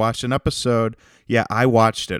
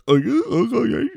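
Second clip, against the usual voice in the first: hype yelp. Pretend. Soundboard hype noise.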